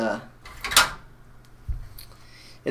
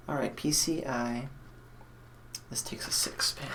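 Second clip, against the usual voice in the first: whispered under the breath, much softer; 11 dB quieter than usual. Real, not acted. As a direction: whisper it to yourself while working. Muttering while wiring the PC.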